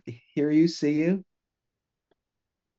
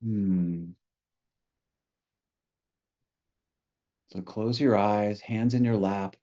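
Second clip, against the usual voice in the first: guided meditation; 2 semitones lower, 3 dB quieter than usual. Real, not acted. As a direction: ease listeners gently into stillness, leaving space between phrases.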